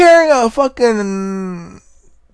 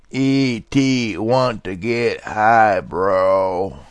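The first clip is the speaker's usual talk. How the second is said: slow, much lower alien voice, dragged out; 10 semitones lower, 5 dB quieter, much slower than usual. Retold or imitated. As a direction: drawl it slowly like a stoned E T. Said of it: "imagine E T saying".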